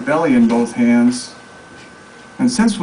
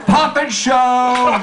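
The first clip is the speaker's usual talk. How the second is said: high, long-held showy shout; 8 semitones higher, much slower than usual. A narrator's reading. Woman swinging a thing at onlookers, high drawn-out shout.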